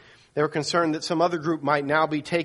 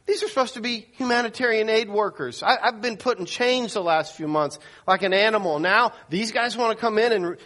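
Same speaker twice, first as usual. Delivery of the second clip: upset protest; 6 semitones higher than usual. Retold or imitated. Keith refuses restraints, upset.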